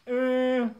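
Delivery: high, loud "uh"; mock alarm squeal. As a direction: squeal as if caught slipping up. Reacts to revealing his name.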